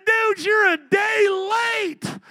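high, slow, drawn-out mock yell; slow mock shout. Pastor hollering his dismay at a missed hunt, drawn out and loud. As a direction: stretch it out like a wail of regret.

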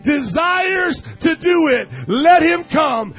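slow, loud, high, near crying; preaching at full force. Real, not acted. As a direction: build it to a crying climax. Preacher building to climax.

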